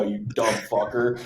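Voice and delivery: usual speaking voice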